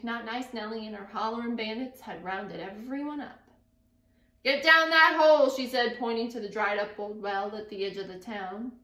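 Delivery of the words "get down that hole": loud, harsh command; barked order. Acted. Not Nice Nellie ordering the townsfolk into the well, harsh.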